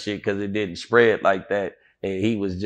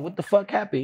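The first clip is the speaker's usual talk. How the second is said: high-pitched disbelieving question; retold shock, pitched up; retold alarmed question; 9 semitones higher, 4 dB quieter than usual. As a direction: demand news in a high voice. Retold reaction to death news.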